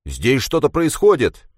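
sudden loud shout of alarm. A narrator's reading. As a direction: call out the warning, loud. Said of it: "вдруг крикнул".

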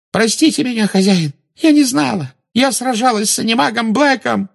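very high, tearful begging; sobbing plea. Acted. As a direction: beg forgiveness through tears. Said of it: "зарыдала Белатриса".

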